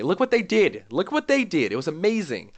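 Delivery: loud, high, excited yelling; excited shout. Pretend. Streamer yelling praise to everybody, loud and high. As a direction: shout it to everybody like flipping a desk. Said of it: "I wanna, like, flip a desk and tell everybody".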